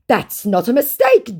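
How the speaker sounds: high, loud correction; emphatic mock-scammer objection. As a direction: protest as the put-on scammer. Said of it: "he puts in".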